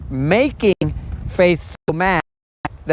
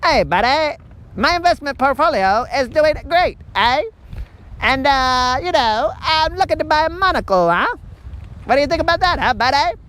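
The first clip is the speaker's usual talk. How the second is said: higher put-on jokey dude voice with a teasing eh and huh; hammed-up buddy voice; 6 semitones higher than usual. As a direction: play a smug buddy bragging about his portfolio, pitched up. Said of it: "I mean".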